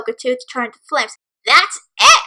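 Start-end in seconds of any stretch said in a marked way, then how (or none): 1.5-2.2 higher, louder outburst; furious yell. Acted. Filly snapping at the bullies, a furious yell. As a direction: snap at last. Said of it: "Fireball yelled".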